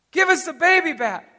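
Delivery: high, milder imitated scream; mock scream. Father quotes his son's scream to a friend, mock scream. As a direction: quote the child's scream at reduced force. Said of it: "He's screaming".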